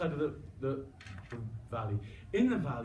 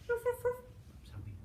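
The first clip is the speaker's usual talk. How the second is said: high squeaky pup; much slower than usual. Pretend. Dog's happiness voiced.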